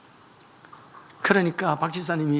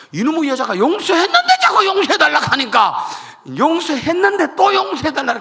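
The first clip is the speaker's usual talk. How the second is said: exasperated preaching; 10 semitones higher, 8 dB louder than usual. Real, not acted. Preacher mimics husband's irritation.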